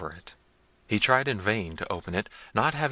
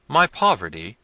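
ordinary delivery, nothing marked

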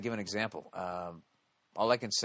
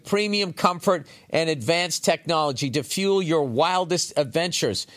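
punchy, louder commercial voice selling trucks; energetic ad read; 8 semitones higher, 10 dB louder than usual. Acted. Host reading a Toyota truck sponsor spot to listeners, upbeat louder ad read. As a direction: sell the Tundra like a radio spot.

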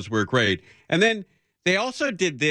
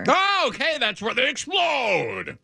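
mock announcer; 13 semitones higher than usual. Mock realization in promo voice.

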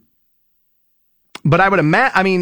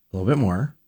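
usual speaking voice

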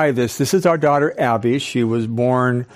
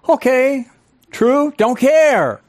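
much higher, slow, drawn-out shrug of a reply; brain's carefree voice; 11 semitones higher, 4 dB louder, slower than usual. Acted. The brain shrugging off the new thought, high slow carefree voice. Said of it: "the brain says what?".

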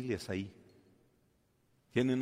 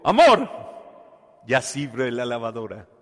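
proud announcement; 9 dB louder than usual. Acted. Husband proudly announces repair.

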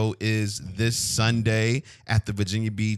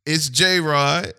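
loud, punched-up name announcement; show intro call; 3 semitones higher, 6 dB louder than usual. Real, not acted. Host redoing his intro for co-host, loud intro call.

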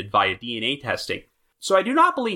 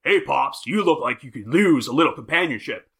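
biker voice. Retold or imitated. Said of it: "where he says, quote".

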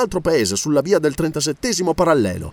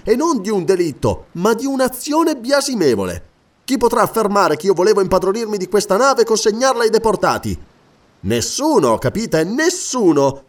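higher-pitched, worked-up prisoner defending himself; animated defiance; 6 semitones higher, 3 dB louder than usual. Acted. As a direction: challenge the accuser with mounting energy. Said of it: "il deportato si animava". (difesa animata e sfrontata)

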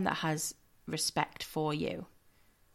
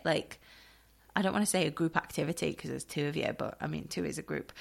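ordinary delivery

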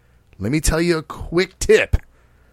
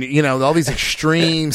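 ordinary talk, nothing marked

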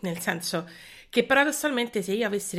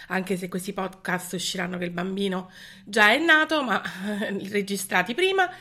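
ordinary talk, nothing marked

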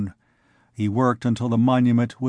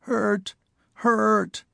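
whimpering; 9 semitones higher than usual. Acted. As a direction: whimper it like a sick pup. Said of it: "and whimpered".

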